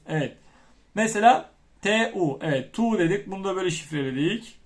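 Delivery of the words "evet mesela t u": usual speaking voice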